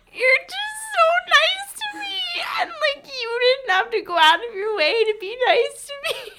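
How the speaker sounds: very high, wailing imitation; mock drunken sobbing. Imitating drunk roommate thanking her in bed, mock sobbing. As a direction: blubber it out in an exaggerated high whine. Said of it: "she gets in my bed and she's sobbing".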